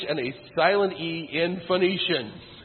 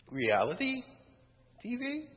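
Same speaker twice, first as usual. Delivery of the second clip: sarcastic question ending much higher; incredulous; 10 semitones higher, 4 dB quieter than usual. Real, not acted. Speaker scoffing at reality TV, with sarcastic disbelief. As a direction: make reality TV sound absurd.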